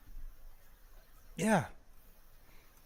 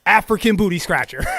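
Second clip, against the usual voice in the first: louder, punchy schoolyard insult; loud taunt; 9 dB louder than usual. Acted. Streamer repeating co-host's insult loudly. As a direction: chant it like a playground jab.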